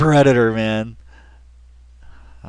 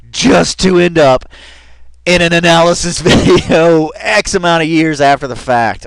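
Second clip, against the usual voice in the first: laughing; 4 semitones higher, 7 dB louder than usual. Real, not acted. Laughing at his fate.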